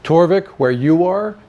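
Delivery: usual speaking voice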